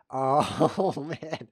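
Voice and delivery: loud oh, then a laugh; groan-laugh at the blunder; loud dismayed laugh. Real, not acted. Reacts to opponent's move.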